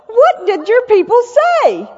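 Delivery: breaking up laughing mid-question. Real, not acted. Laughing while retelling the story.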